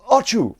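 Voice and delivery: loud high "Archu" sneeze; fake sneeze. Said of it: "I'm so sorry I sneezed there".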